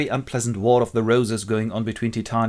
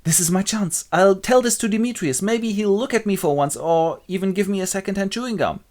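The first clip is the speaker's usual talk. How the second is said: higher-pitched scheming Helena; hopeful Helena; 7 semitones higher than usual. Acted. Helena plotting to tell Demetrius, hopeful. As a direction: lift the voice like a hopeful girl. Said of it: "and Helena goes".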